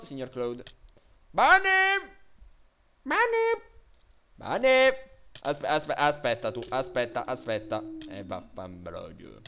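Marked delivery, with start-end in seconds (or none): 1.3-4.9 high, loud call for the pet. Real, not acted. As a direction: shout the pet's name from far off. Calling pet loudly across map.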